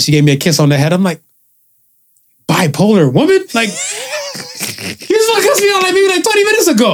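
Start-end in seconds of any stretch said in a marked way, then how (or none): 2.5-7.0 high, laughing disbelief; incredulous laughing squeal. Retold or imitated. Mocking mom in retelling.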